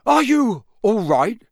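high, loud worried cry; shaky alarm. A narrator's reading. Terrified father checking on the children, shaky and alarmed. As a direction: check anxiously that everyone survived. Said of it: "His voice sounded shaky".

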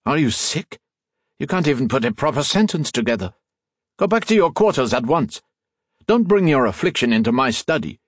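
haughty, exasperated dressing-down; imperious scolding. A narrator's reading. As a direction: berate the servant and order him out. Master berates and orders servant.

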